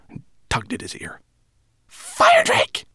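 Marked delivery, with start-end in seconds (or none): 1.9-2.9 piercingly high shouted name. A narrator's reading. Rat shouting into sleeping dragon's ear, high shout. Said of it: "she shouted".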